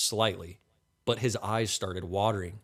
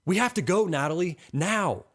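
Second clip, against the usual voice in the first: high, raised urgent call; urgent yell; 8 semitones higher, 4 dB louder than usual. A narrator's reading. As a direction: yell it as if you must flee. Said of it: "runs up to her and yells".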